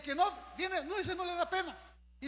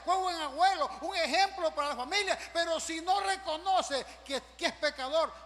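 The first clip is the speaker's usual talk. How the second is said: high, loud, insistent pulpit delivery; raised, driving preacher voice; full-force preaching; 6 semitones higher, 6 dB louder than usual. Acted. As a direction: preach hard, piling up each phrase.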